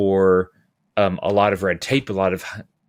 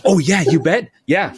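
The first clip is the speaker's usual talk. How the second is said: bright eager yes, pitched up; eager British locator; 8 semitones higher, 5 dB louder than usual. Retold or imitated. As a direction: play the keen locator lighting up. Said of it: "And they're like".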